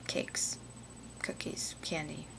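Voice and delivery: hushed, longing whisper. Acted. Woman naming the foods she calls her friends, whispering. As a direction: whisper each food like a departed friend.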